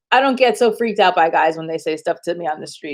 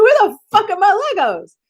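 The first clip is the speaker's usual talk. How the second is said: put-on higher and indignant, a kid demanding toys; hammed-up kid outrage over missing Legos; mock childish outrage; 11 semitones higher than usual. Little girl at Christmas demanding her Legos, mock indignant. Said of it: "I was like".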